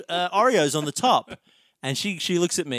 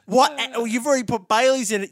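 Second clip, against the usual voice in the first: higher, quick doubtful retort; skeptical challenge; 9 semitones higher, 4 dB louder, faster than usual. Retold or imitated. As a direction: poke holes in it. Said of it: "And she says".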